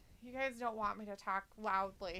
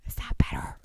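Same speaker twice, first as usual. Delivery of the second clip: whisper in your ear; 9 dB louder than usual. Real, not acted. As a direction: close whispered question. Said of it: "I'm whispering in your ears".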